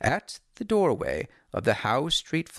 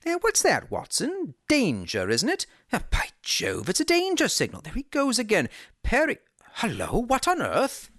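higher, keen detective voice reading the signals; a sharp-eyed sleuth thinking aloud, pitched up; Holmes voice; 10 semitones higher than usual. A narrator's reading. Holmes at the window to Watson, excited and higher.

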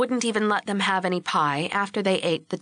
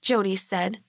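ordinary delivery

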